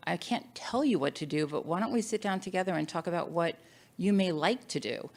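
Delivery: normal talking voice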